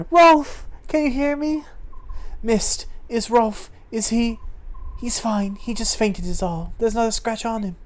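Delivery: put-on gently checking on a fainted boy; worried girl. Voices worried characters.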